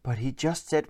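plain reading voice

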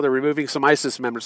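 ordinary delivery

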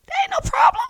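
squealing very high with delight, rising at the end; overjoyed. Pretend. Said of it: "I would be so happy".

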